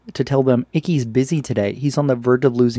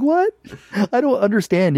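put-on confused kid; 9 semitones higher than usual. Icky confused, quoted.

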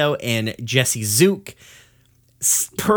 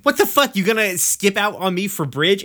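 Amethyst outraged; 7 semitones higher than usual. Retold or imitated. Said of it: "And Amethyst is like".

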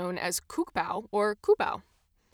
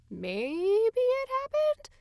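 very high, drawn-out rising question; big question mark; 14 semitones higher, much slower than usual. Acted. Podcaster weighing whether a myth is true, exaggerated questioning. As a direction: stretch it slowly up into squeaky doubt. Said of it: "I treat myth with a big old question mark".

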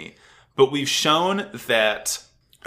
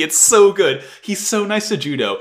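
ordinary talk

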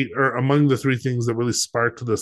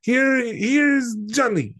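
Ed McMahon impression; 11 semitones higher than usual. Host imitates Kaufman imitating McMahon for the guest. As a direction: stretch out the Johnny intro high. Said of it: "I do an impression of Ed McMahon".

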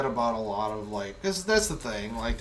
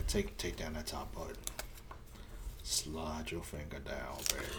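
sexy deep voice; 9 semitones lower, 13 dB quieter than usual. Pretend.

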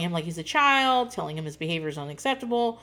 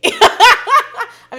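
loud laugh; 15 dB louder than usual. Real, not acted. Vlogger laughing at her own joke about the jeans, loud and high. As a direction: burst of laughter, much higher and louder.

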